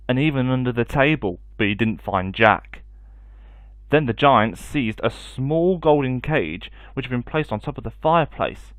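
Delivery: ordinary delivery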